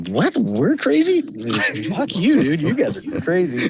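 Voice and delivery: imitating an outraged comeback; incredulous retort. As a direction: toss the insult right back, half laughing. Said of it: "And I'm like".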